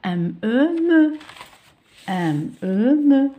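"M, E" climbing to a loud, high, held "me"; teacher modelling "M, E, me" in a sing-song rise; syllable sounding-out. Shown as a demonstration. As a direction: draw out the letters, then punch "me". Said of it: "ونقرأ في نفس الوقت".

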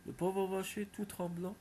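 plain reading voice

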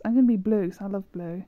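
usual speaking voice

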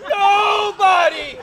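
furious yell. Acted. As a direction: scream it out in cartoon rage. Wife insulted, hammy rage.

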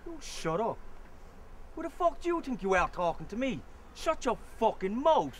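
furious shout. Acted. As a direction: tear into him, spitting each word. Jealous boyfriend confronts rival.

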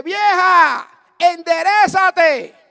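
very loud, high, drawn-out command; megaphone shout. Retold or imitated. Preacher commanding the old lady by megaphone, shouting. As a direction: bellow it as if through a megaphone. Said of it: "saca el megáfono".

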